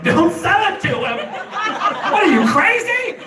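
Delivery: high-pitched comic outrage; mock-horrified, high protest; appalled drug dealers. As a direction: imitate dealers scandalised, pitch shooting up. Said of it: "drug dealers would be like".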